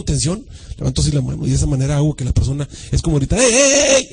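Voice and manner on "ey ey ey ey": high, loud repeated hey; attention-grabbing call. Shown as a demonstration. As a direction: call out to a drifting listener. Demonstrates calling for attention.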